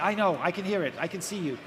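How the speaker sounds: usual speaking voice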